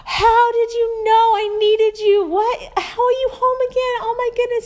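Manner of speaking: a son overcome, crying to his mother; imitating crying son. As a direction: voice breaking with relief and tears. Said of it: "He starts just crying".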